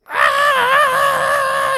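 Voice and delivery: shrill high goblin shriek of pain; goblin death scream. Acted. GM voicing burning goblins, shrill death scream.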